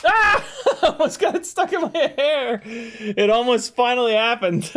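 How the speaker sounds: laughing after a near miss. Real, not acted. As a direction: laugh through the words.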